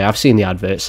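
ordinary talk, nothing marked

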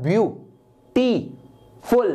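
View, टी, फुल split by clear pauses; syllable by syllable. Shown as a demonstration. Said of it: "आपको बोलना पड़ता है".

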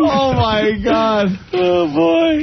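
high, drawn-out amazement; amazed. Real, not acted. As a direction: gasp at the picture. Reacts to risqué photo.

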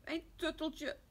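put-on high-pitched little boy's whine; tearful wail. Tommy wails for his lost tutteltje to his sister, tearful wail. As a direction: wail it like an upset toddler. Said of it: "huilt Tommy als hij zijn zusje ziet".

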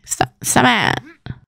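slow whisper; whispered stop. Real, not acted. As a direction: hush it out slowly under your breath. Scolding Sim under breath.

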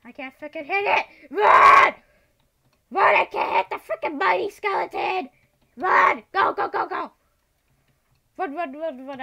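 screaming in panic, high and loud; frantic yells while fleeing; panicked screaming. Real, not acted.

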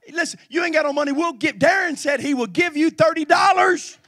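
loud, high, rolling sermon cadence; full-force preaching. Acted. Pastor hyping the church event to the congregation at full force. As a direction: pound the announcement to the pews.

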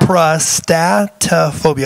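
Coprostatophobia slowly, each syllable picked out; every syllable sounded. Real, not acted. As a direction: take care over every syllable of Coprostatophobia. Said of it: "I have to say it like that to make sure I get all of the syllables".

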